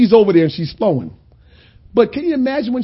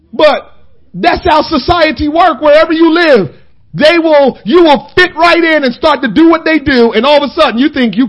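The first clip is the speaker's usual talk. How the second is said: louder, higher preaching push; full-force preaching; 7 semitones higher, 6 dB louder than usual. Acted. Preacher rising on society's pull, full-force preaching. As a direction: drive the point home to the church.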